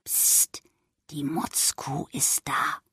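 breathy hush, very soft; grandmother's urgent whisper; grandma whispering. Acted. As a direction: shush the room like a careful grandma. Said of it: "flüsterte die Oma".

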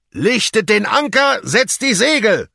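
high, loud shouted order; captain's command. A narrator's reading. Captain ordering the sailors to set sail, loud shout. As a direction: bark the order across the deck. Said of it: "rief der Kapitän".

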